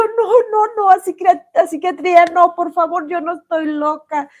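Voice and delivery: high, loud, frantic begging, as if terrified; shrill-edged scared protest, fading toward the end; panicked pleading. Retold or imitated. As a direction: plead desperately not to be sent away. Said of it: "Y yo".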